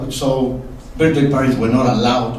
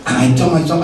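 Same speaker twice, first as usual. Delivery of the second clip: normal talking voice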